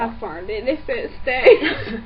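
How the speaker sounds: slow laughing talk; giggling through the words. Real, not acted.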